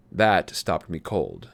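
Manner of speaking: ordinary delivery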